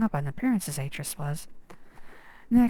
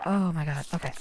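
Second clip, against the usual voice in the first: usual speaking voice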